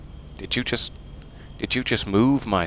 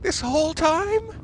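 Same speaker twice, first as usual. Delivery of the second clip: much higher, stretched-out disbelief. Real, not acted. To chat, finding out the plant was fake, astonished. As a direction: gasp it out in shock.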